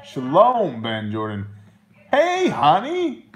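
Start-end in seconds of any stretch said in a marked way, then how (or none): 2.1-3.2 high, slow, sing-song hello to his wife; a lovey-dovey high hello for his honey; sweet greeting. Real, not acted.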